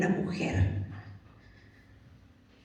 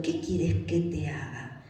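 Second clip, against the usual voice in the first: slow, lower and softer question; calm, tender asking; 5 semitones lower, 8 dB quieter, slower than usual. Acted. Jesus asking the blind man his wish, slow tender question. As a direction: ask gently, taking your time.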